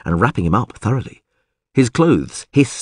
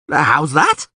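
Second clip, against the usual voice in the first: raised, startled question; shouting; 6 semitones higher than usual. A narrator's reading. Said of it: "shouted Dandelion".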